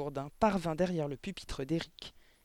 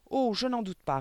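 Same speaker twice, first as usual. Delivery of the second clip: higher-pitched, mildly mocking tone; sneering reply; 6 semitones higher, 4 dB louder than usual. A narrator's reading. Headmistress shrugs off the teacher's protest, sneering. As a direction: answer with a touch of a sneer. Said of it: "répondit mademoiselle Le Gourdin en ricanant".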